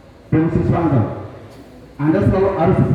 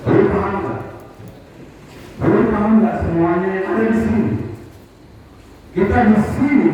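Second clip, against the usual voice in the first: ordinary talk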